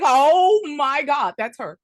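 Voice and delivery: recognize her with a loud gasp; excited recognition. Retold or imitated. Retold recognition, loud start.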